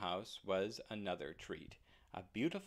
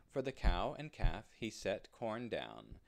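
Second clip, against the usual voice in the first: usual speaking voice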